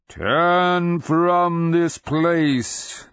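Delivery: very slow, high, loud dragon command; booming dragon voice. A narrator's reading. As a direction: stretch it out as a booming warning. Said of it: "dragon's booming echo".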